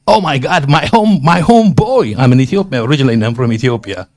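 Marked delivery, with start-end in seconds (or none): 0.1-2.1 delighted, higher exclamation of surprise; recognizing a neighbor in the crowd; surprised greeting. Retold or imitated. Man spotting his neighbor at the embassy, surprised and delighted. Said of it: "what came out of me is".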